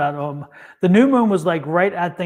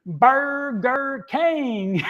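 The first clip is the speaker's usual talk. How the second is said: very slow Southern drawl, pitched higher; Leslie Jordan's Southern accent; 5 semitones higher, much slower than usual. Acted. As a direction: stretch each syllable into a Southern punchline. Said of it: "just like that with that accent".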